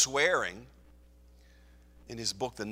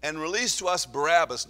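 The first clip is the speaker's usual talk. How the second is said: crowd crying out; 4 semitones higher, 7 dB louder than usual. Acted. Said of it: "They all crowd, cried out at once".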